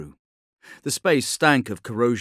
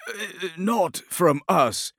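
slow, much higher hesitant reply; hesitant officer; 9 semitones higher, much slower than usual. Acted. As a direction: hedge the answer. Alibus answers hesitantly.

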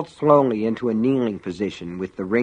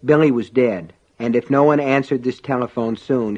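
plain reading voice

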